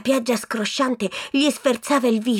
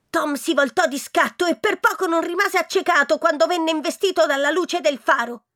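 ordinary delivery, nothing marked

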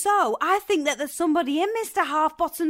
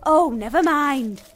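ordinary talk, nothing marked

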